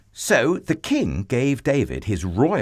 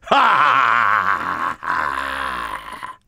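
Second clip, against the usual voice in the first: terrible giant laugh; 4 semitones lower, 4 dB louder than usual. A narrator's reading. Giant laughs at God's terrified people, terrible booming laugh. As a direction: laugh hugely and let it roll downwards. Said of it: "he laughed his terrible laugh".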